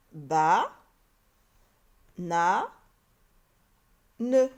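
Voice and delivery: ba, na, ne, each loud and alone; long pauses between drawn-out ba, na and ne; syllable by syllable. Shown as a demonstration. Teacher building banane for pupils, one separated syllable at a time. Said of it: "ces trois syllabes vont me donner le mot".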